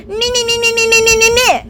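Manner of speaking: high, whiny me-me-me chant; mock the partner's selfish wailing; mock self-centred screaming. Partner screaming over her complaints, mocked in a high whine. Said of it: "screaming in my face".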